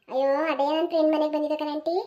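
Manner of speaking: put-on meek teenage girl making excuses; sheepish girl. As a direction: shrink under the aunt's questions. Girl answering aunt sheepishly.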